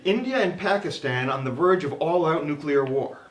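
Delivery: lightly mocked TV news teaser, pitch lifting as it goes; a hint of newsreader hyping the next story. Comic retelling a school-era newscast to an audience, mock news teaser. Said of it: "began with a teaser".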